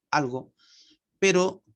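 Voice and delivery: usual speaking voice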